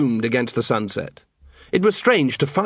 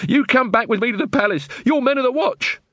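high-pitched demand; indignant Muldoon; 12 semitones higher than usual. Acted. Muldoon ordering the watch back to the palace, indignant. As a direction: pull rank in a high, urgent voice.

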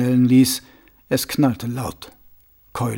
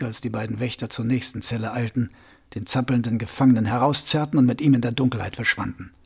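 ordinary delivery

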